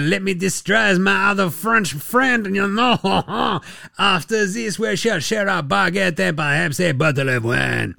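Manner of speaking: hammed-up French accent, slow and drawn out; mock French accent. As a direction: play a caricature French sportsman. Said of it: "all accents and vocalizations approximate".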